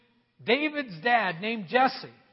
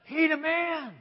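impressed prophet; 4 semitones higher than usual. Acted. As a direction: sell him like a hype man. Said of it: "he's like".

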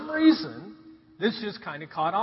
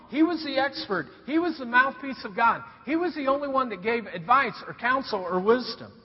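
ordinary talk, nothing marked

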